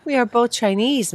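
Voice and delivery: urgent plea. Acted. Pleading with stranger.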